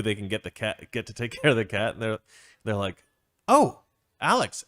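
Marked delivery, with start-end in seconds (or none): 3.5-4.6 mock realisation. Couple recalling Alex.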